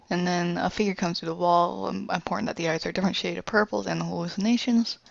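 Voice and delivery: ordinary delivery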